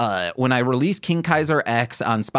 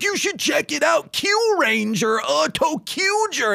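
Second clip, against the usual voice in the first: mock pushy fan; 13 semitones higher than usual. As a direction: push the show on me like an eager fan. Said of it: "And they're like".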